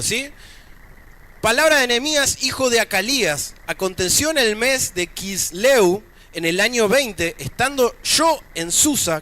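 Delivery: emphatic, projected Bible reading from the pulpit; scripture reading. Acted. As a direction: proclaim the passage phrase by phrase. Reads scripture aloud to congregation.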